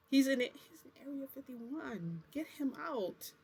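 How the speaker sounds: retold high-pitched plea; urgent plea. Pleading with him to rescue a friend, urgent retold plea.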